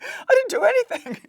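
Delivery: high, loud, near-crying protest; panicked denial. Retold or imitated. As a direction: cry out your innocence in panic. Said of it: "I'm like".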